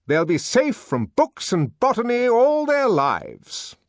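high-pitched director proclaiming his method; triumphant lecturing director. Acted. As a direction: hold forth as the director, high and declamatory.

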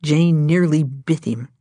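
usual speaking voice

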